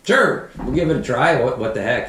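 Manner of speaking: high, loud, carefree shrug of a reply; game agreement. Retold or imitated. Answering the farmer at the auction, high and carefree. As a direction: toss it off like why not. Self-quote, gamely agreeing.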